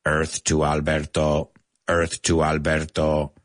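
low alien voice calling Earth; a hint of a spaced-out alien. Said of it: "in our alien voice".

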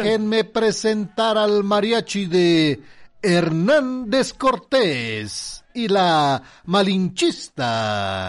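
announcer voice. Acted. Presenting the mariachi.

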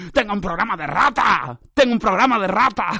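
loud, silly proclamation; mock proud exclamation. Podcaster announcing his rat programme, silly exclaiming.

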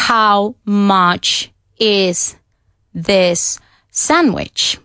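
"How much is this sandwich" spoken very slowly, each word separate; every word said apart with gaps. Shown as a demonstration. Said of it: "nadie dice".

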